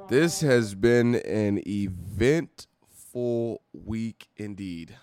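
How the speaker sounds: drawn out, slow and weighty; slow dramatic intro. Pretend. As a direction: stretch it out like a big announcement. Dramatic show opener.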